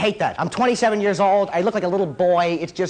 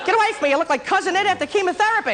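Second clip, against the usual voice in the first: high-pitched mock disgust; recoiling date; 11 semitones higher, faster than usual. Woman rejects her hyper date, high-pitched mock revulsion. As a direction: play a put-off date in a high voice.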